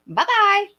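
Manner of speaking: high, loud singsong send-off; mock dismissive farewell. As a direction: dismiss them loudly, high and playful. Repeats mock dismissal of doubters.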